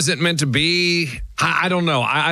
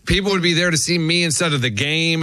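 ordinary talk, nothing marked

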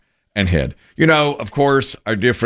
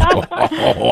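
laughing; 10 semitones higher than usual. Real, not acted. Laughing after hospital joke.

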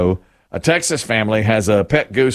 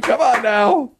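usual speaking voice

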